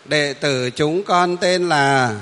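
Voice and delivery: slow, loud model recitation; teacher demonstrating how to read out loud. Shown as a demonstration. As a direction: project each drawn-out syllable for the group to copy. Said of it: "đọc to lên chứ".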